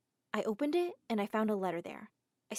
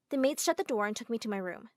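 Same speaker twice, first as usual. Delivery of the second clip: plain reading voice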